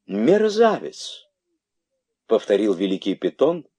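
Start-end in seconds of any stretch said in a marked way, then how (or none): 0.1-1.3 высокий, протяжный возмущённый переспрос; переспроси высоко, не веря наглости; гневное изумление. A narrator's reading. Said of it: "не находя слов от гнева". (гневно и изумлённо)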